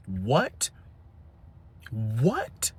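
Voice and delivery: low, soft incredulous what; can't believe what he heard, low; stunned disbelief. Real, not acted. Disbelief at the mom.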